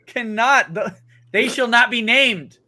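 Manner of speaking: hammed-up panic to stop a summoning; half-joking dread; mock alarm. Friend jokingly summons Cthulhu.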